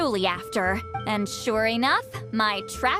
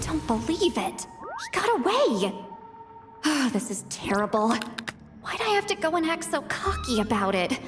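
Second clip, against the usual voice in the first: frustrated wail to yourself; exasperated self-reproach; dismayed lament; 2 semitones higher than usual. Acted. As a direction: bemoan your blunder, pitch swinging up. Alone, lamenting the escape.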